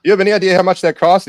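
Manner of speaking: high, indignant shop owner voice; mock shopkeeper. Friend retelling a viral video, voicing the store owner indignantly. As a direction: act out the outraged Italian store owner. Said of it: "He's like".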